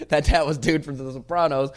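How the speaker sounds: loud, high, amused laughter through the words; laughing. Real, not acted. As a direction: crack up at your own story while talking. Laughing at his own story.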